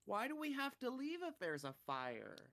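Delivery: high-pitched imitation of a puzzled kid; questioning child. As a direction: mimic a little kid asking why. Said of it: "My kids are like".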